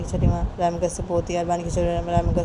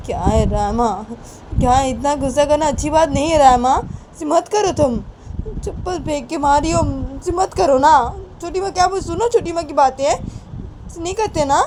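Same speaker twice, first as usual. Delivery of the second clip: pleading Bani; 9 semitones higher, 4 dB louder than usual. Acted. Bani asking Rama to heed Chhoti Maa, high-voiced pleading. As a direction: implore her to stop.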